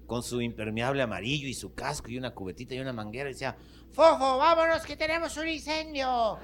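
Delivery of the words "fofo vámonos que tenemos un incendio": put-on very high four-year-old voice, drawn out; little boy. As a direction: play a tiny fireman calling his dog. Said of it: "Venía un niñito como de cuatro años".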